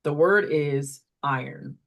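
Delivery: "iron" said slowly in sample sentences; slow teacher model. Shown as a demonstration. Teacher modelling iron in sentences for a student, slowly. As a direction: read the example sentences slowly for kids. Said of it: "what to do if the correction doesn't work".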